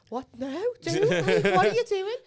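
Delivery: high, loud mock alarm; alarmed disbelief. Teller retelling her shock at the tipsy friend, high and loud. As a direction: yelp it like you can't believe her. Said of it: "And I was like".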